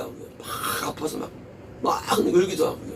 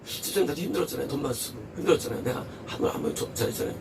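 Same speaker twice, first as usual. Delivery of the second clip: soft, quick, lower voice reasoning with a deacon; imitated reasonable talk; 4 semitones lower, 7 dB quieter, faster than usual. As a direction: play someone quietly making a sensible-sounding case. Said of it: "아주 그냥 논리적으로 말합니다". (조용하고 빠르게 논리적으로 따지는 흉내)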